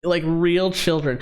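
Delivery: ordinary delivery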